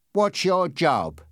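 slow, rudely blunt question. A narrator's reading. Policeman questioning the passenger, rude and emphatic. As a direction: ask it with heavy, rude emphasis. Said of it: "he asked rudely".

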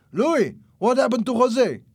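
high, loud, alarmed demand; shouting. A narrator's reading. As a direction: yell the question across. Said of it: "shouted the man".

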